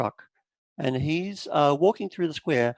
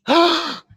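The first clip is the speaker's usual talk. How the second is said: shocked gasp; 13 semitones higher, 3 dB louder than usual. Acted. Mother sees her son.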